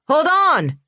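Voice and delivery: sharp, high, loud cry to stop the others; sudden call out. Acted. Bree spotting a print calls out to her partners, sharp loud cry. Bree spots a track in snow.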